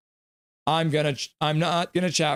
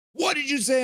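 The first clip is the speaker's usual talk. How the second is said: pitched way up, demanding; mock angry challenge; 12 semitones higher than usual. Guy at party confronting the storyteller, mock outraged and high. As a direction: imitate the offended guy's outraged demand.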